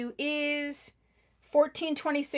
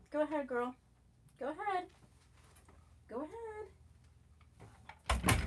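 soft aside; 3 semitones higher, 12 dB quieter, faster than usual. Real, not acted. Letting the cat Kit Kat out.

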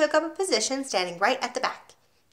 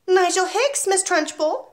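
put-on 1 small boy voice; 10 semitones higher, 4 dB louder than usual.

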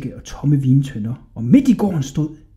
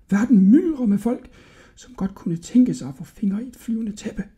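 put-on high, hushed old man's voice; cautious old shopkeeper; 8 semitones higher, 4 dB quieter than usual. A narrator's reading. El Fazer warning Hodja of eavesdroppers, hushed and high. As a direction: warn the boy confidentially, high and soft.

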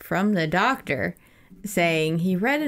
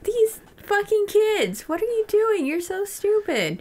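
much higher, slow mock-angry rant at kids; grumpy old self. Future self scolding kids on the video, mock-angry and high. You'd be like.

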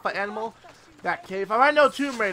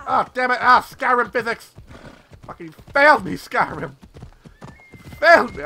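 loud exasperated rant; frustrated yelling; 4 semitones higher, 7 dB louder than usual. Real, not acted. Player yells at Skyrim physics for failing her. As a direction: yell at the game.

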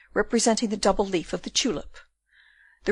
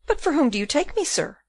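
higher-pitched, lightly voiced puzzled question; puzzled Rosa; 6 semitones higher than usual. A narrator's reading. Puzzled Rosa to the burgomaster, higher questioning voice. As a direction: ask it back in a lightly voiced higher register.

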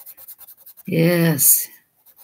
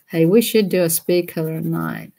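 usual speaking voice

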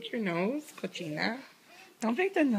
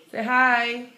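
usual speaking voice

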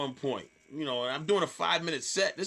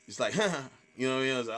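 ordinary talk, nothing marked

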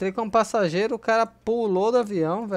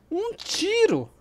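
shocked cry; 12 semitones higher, much slower than usual. Real, not acted. Killed by one shot.

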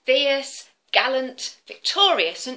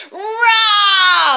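loud high dragon roar; huge fiery dragon roar; 11 semitones higher, 13 dB louder than usual. Acted. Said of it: "Let's hear you, everybody".

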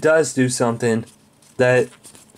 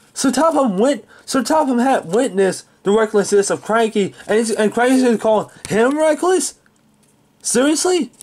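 high-pitched, incredulous questioning; indignant disbelief; 4 semitones higher than usual. Real, not acted. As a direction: challenge it in rising disbelief. Indignant rebuke of Cranky's remark.

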